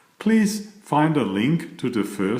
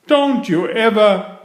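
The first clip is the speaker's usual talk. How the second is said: higher, louder cut-off warning; scolding mother; 10 semitones higher, 7 dB louder than usual. Acted. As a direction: start a furious telling-off.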